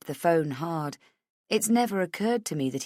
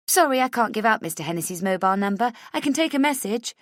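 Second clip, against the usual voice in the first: higher, fast receptionist voice; brisk receptionist; 6 semitones higher, 4 dB louder, faster than usual. A narrator's reading. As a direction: rattle it off like a busy receptionist. Receptionist refuses politely.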